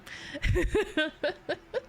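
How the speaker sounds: high rising giggle. Real, not acted. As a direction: giggle at the joke. Laughs about smell.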